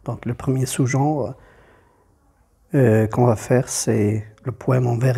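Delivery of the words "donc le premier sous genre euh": ordinary delivery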